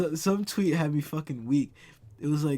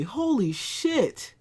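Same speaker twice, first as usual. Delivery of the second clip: astonished; 8 semitones higher, much slower than usual. Real, not acted. Just found Popeyes in Germany, astonished cry. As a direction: stretch it out in amazement.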